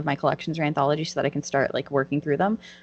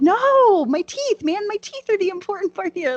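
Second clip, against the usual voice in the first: exasperated insistence; 13 semitones higher, 6 dB louder than usual. Retold or imitated. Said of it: "And I'm like".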